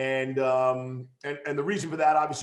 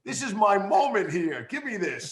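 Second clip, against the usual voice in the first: playfully insistent, slightly raised plea; mock pleading protest; 4 semitones higher than usual. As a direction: beg for your moment, half in jest. Acted plea to impatient colleagues.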